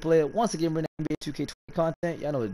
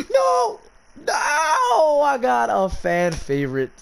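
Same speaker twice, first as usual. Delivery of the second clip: high wailing cry of defeat, then drawn out; anguished shout; 13 semitones higher, 5 dB louder, much slower than usual. Real, not acted. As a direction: howl the loss, stretching the words. Loses the spin he wanted.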